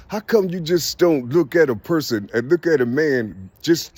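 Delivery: normal talking voice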